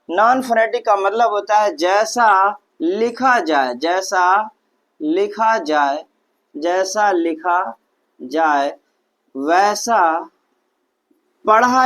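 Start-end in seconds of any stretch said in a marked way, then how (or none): 1.8-10.3 slow dictation. Shown as a demonstration. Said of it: "slow dictation of rule".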